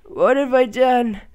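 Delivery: loud, slow desperate pleading; panicked. Real, not acted. Gamer begging as the game freezes, panicked. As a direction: beg the game to survive.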